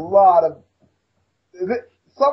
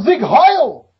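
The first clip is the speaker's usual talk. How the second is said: a higher, louder hammed-up salute cry; mock Nazi shout; 6 semitones higher, 7 dB louder than usual. Mocking the promo, a hammed-up Nazi shout. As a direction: bark the salute like a mock rally.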